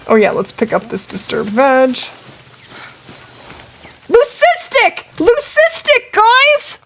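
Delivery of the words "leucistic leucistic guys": high, delighted squeal of discovery; excited shout. Real, not acted. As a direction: yell it out like you just hit the jackpot. Spots rare leucistic animal live.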